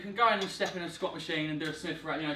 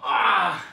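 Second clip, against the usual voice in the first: loud strained grunt on the last rep; effort grunt; 10 dB louder than usual. Real, not acted. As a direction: push out a loud effort sound.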